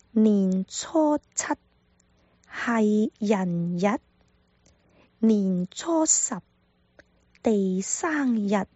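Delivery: 年初七 系人日 spoken very slowly; slow dictation. Shown as a demonstration. Teacher dictating homework phrase to viewers, very slow. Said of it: "听好了".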